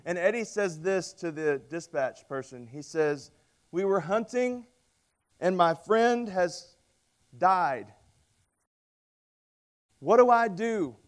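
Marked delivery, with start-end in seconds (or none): 3.7-11.0 higher, louder panicked caller. Retold or imitated. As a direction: plead down the phone in panic. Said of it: "And he's panicked".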